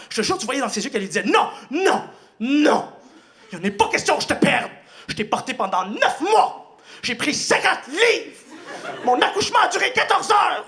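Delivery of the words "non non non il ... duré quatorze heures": high, loud desperate rant; mother screaming her child must not die; frantic mother. Acted. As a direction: rant like a terrified furious mother. Mother pleading in fury.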